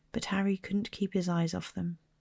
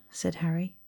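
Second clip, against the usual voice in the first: plain reading voice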